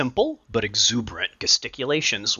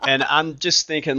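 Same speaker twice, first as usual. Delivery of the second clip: ordinary delivery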